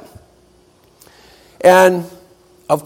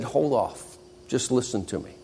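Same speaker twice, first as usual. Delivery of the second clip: much softer, low steadying voice; exhausted Pete calming the upset students; quiet calming; 4 semitones lower, 12 dB quieter than usual. Acted. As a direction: hush them gently. Pete quietly calms them.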